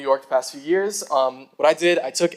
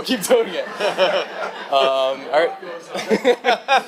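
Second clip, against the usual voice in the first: laughing; 4 semitones higher, much slower than usual. Real, not acted. Laughing at own closing remark.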